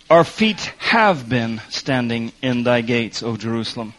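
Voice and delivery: "have been standing" drawn out slowly; stress on have been. Shown as a demonstration. Preacher stressing past tense for the congregation, slow. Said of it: "it's a fact, past tense".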